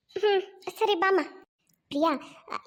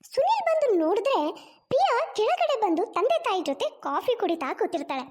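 higher-pitched, lilting storyteller voice; story narration; 4 semitones higher than usual. A narrator's reading. Storyteller moving on to the wedding, lilting narration. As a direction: narrate with big rises and falls.

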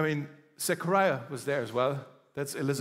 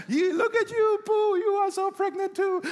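put-on very high, loud girlfriend squeal; sassy girlfriend squeal; 4 dB louder than usual. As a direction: gush over her pregnant friend at the top of your voice. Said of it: "Like, I don't know, you know".

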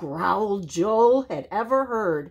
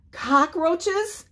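high, rising disbelief; appalled question; 11 semitones higher than usual. A narrator's reading. Animals hearing what is in the pie, aghast. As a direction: squeak it as a shocked question.

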